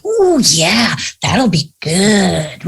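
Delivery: drawled, mock-casual agreement; mock agreeing. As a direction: play along, slow and easy. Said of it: "We're like".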